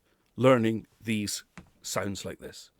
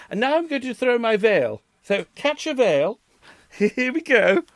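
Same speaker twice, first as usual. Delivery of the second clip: playful bride; 10 semitones higher, 3 dB louder than usual. Pretend. Mock bride throwing her veil.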